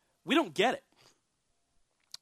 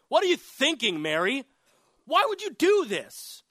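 high, loud, indignant rebuke; scolding; 7 semitones higher, 5 dB louder than usual. Acted. Disciples rebuking Mary over the ointment, loud acted scolding. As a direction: scold her in disbelief, voice jumping up. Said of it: "And they scolded her".

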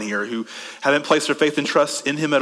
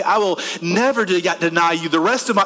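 higher, gushing zeal; mock fervent vows; 4 semitones higher than usual. Imitated believer promising Jesus everything after worship, gushing. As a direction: rattle off promises with breathless enthusiasm.

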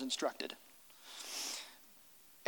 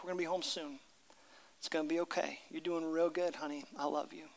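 much softer, gentle near-whisper; tender whisper; 3 semitones lower, 12 dB quieter, faster than usual. Retold or imitated. Father whispering to his daughter in hospital, tender. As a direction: hush it to a sick child. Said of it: "Todd whispers these words to her".

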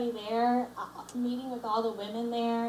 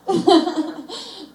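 loud burst of embarrassed laughter; 7 semitones higher, 11 dB louder than usual. Real, not acted. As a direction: laugh it off, caught out. Caught revealing secret part.

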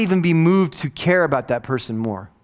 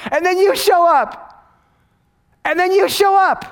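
much higher, louder, slow preaching peak; soaring climax; 7 dB louder, slower than usual. Acted. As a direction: lift the whole room with each word. Sermon climax.

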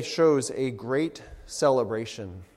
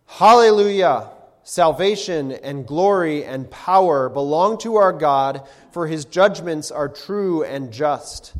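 crying out; 2 semitones higher, 8 dB louder than usual. Acted. Preacher voices heaven's multitude crying out, loud praise. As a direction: proclaim it like a great multitude shouting in heaven. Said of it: "crying out".

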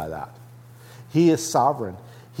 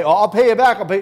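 a higher, louder, hammed-up sinner insisting he'll repay; mock sinner voice; 7 semitones higher, 8 dB louder, much faster than usual. Preacher mocks a believer promising to repay God, loud and high. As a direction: play a naive believer sure he can repay God, lightly mocked. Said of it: "You can say".

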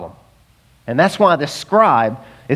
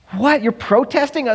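incredulous; 8 semitones higher than usual. Acted. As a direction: question him in mock astonishment. Intervention team confronts the hoarder.

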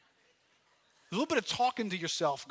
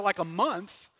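normal talking voice

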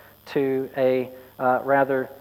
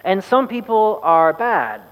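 slow and loud; 4 semitones higher, 3 dB louder, slower than usual. Acted. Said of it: "he said slowly and loudly".

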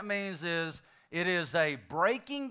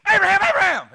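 high, loud pointed call of the name; 16 dB louder, much faster than usual. Acted. Jesus shouting to Abraham alone, a high loud call. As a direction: shout it so it hits just him. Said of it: "how loud you gotta shout".

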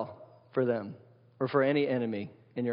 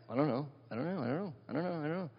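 a quiet, careless shrug of a voice, repeated on a loop; mock shrugging I-don't-know; 7 dB quieter, faster than usual. Pastor mocking people who shrug off the big questions, lightly mocking and quiet. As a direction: mumble it like someone who can't be bothered. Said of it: "the religion of "I don't know-ism".

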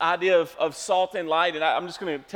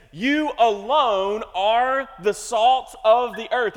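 "You alone are the salt of the earth" slow and louder, stress on "You"; slow emphatic reading; 3 semitones higher, 7 dB louder, slower than usual. Shown as a demonstration. Pastor reading the verse to his church, slow and emphatic. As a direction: read it slow, leaning on "You". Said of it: "it could read like this".

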